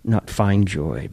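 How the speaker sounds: ordinary talk, nothing marked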